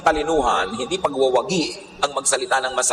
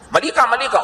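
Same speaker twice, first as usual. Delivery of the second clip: sharply higher, scolding; mock angry retort; 10 semitones higher than usual. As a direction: throw the blame back at them. Angry person's quoted retort.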